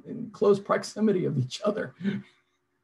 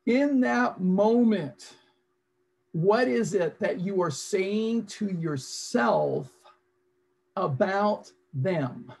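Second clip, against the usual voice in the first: slow reflective prompt; slower than usual. Shown as a demonstration. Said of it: "slow reflective coaching prompt".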